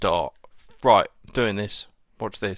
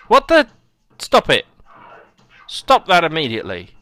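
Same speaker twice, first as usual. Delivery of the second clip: high, loud indignant cries; indignant protest; 5 semitones higher, 5 dB louder than usual. Real, not acted. Gamer telling an enemy to stop, loud indignant protest. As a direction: cry out in protest, starting highest.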